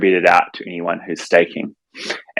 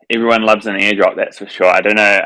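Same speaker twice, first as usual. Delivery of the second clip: normal talking voice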